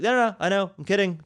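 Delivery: high, insistent mock reassurance; imitated defensive "just kidding"; joking backpedal. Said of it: "I jokingly was like".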